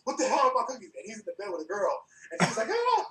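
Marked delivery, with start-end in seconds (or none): 0.0-0.9 mock angry yell at the roommate; retold angry shout, a touch higher; angry demand. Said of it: "and I got pissed". 2.7-3.1 high mock shriek of a startled girl; imitated high girlish shriek; startled girl's shriek.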